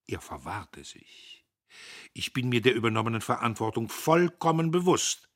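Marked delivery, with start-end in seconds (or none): 2.1-5.3 put-on louder, higher, stiffly solemn young man; Dietrich pompous. Dietrich assures Agnes of his responsibility, pompously louder. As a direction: pronounce duty like an official oath.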